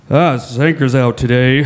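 low, sluggish mumbling-host impression; impression of Lee on solo episode. Co-host mocking a solo phone-recorded episode, low put-on voice. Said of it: "talk like this".

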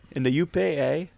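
put-on a quick, half-hearted stab at a Yooper accent; bad Yooper accent. Host jokes about the U.P. in a bad Yooper accent. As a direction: toss off a bad Upper Peninsula accent. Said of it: "That was a really bad accent on my part".

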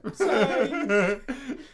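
a sudden burst of laughter; loud laugh. Real, not acted. Laughs at the joke.

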